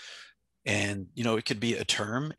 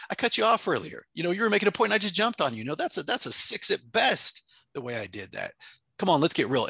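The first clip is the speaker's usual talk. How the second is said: higher, louder self-critique; calling myself out; 8 semitones higher, 3 dB louder than usual. Retold or imitated. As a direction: call yourself out with energy. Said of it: "I'll call myself".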